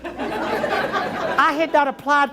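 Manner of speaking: laughing. Real, not acted. Comic punchline with laugh.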